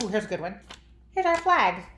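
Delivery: ordinary talk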